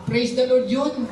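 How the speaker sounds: ordinary talk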